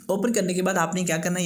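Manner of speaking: ordinary delivery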